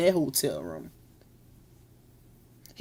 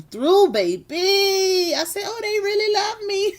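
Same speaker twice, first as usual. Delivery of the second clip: high, laughing and half crying; 11 semitones higher than usual. Real, not acted. Streamer thanks her supporters, laughing through tears. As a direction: overcome with giddy joy.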